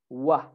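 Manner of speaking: "wa" with rounded lips, no V; a pure W glide on "wa"; W sound. Shown as a demonstration. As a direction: say "wa" as a low, rounded W. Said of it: "instead of V, you say".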